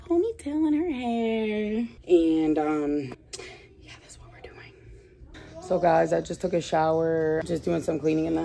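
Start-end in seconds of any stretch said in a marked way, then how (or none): 3.8-4.7 whispering. Real, not acted. Sick mom trailing off.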